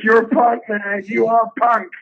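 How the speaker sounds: high, emphatic repeat of the praise; imitating Paul again. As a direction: pile it on, high and loud. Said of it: "He goes".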